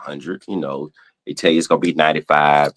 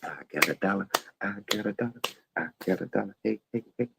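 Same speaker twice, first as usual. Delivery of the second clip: hushed quote in a little character voice; very soft, halting catchphrase impression; Buckwheat; 14 dB quieter, much faster than usual. Streamer quoting a Little Rascals character, softly imitated. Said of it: "as my dog Buckwheat would say".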